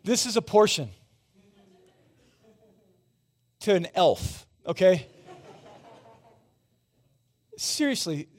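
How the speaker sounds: energetic preaching. Acted. Pastor teasing the church about tiny cereal portions, loud and high. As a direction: play up the joke for the room.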